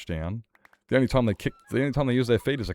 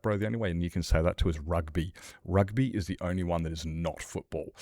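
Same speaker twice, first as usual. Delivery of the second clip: ordinary talk, nothing marked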